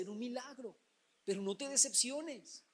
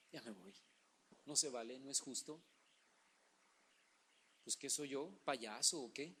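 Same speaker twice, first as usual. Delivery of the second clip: much lower and softer, pouting; sulking Naaman; 9 semitones lower, 8 dB quieter than usual. Acted. Naaman leaving in a huff, low pouting grumble. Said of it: "Naaman sulks".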